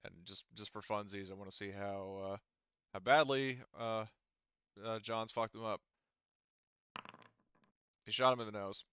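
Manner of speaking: ordinary talk, nothing marked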